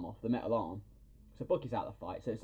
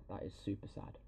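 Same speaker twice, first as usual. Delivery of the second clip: breathy whisper, sad and soft; whispered sad aside; 10 dB quieter than usual. Retold or imitated. Whispering how sad the scene felt. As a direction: hush it like it hurts. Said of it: "I was like".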